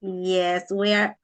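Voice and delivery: normal talking voice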